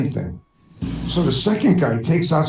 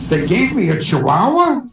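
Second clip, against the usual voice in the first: shocked; 3 semitones higher, 3 dB louder than usual. Acted. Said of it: "the guy says".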